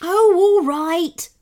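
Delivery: high, loud, drawn-out sloth voice; sloth calling back. A narrator's reading. Sloth driver to Kenny behind him, calling back. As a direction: call back slowly in compliance. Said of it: "called back the sloth".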